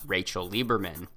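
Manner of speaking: normal talking voice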